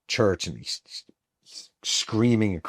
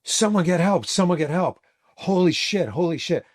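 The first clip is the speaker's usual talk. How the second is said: high-pitched mock screaming for help; retold panicked scream; 10 semitones higher, 3 dB louder than usual. Backstage crew finding their fallen friend, retold screaming. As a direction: imitate frantic screaming, much higher but not full volume. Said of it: "And they start screaming".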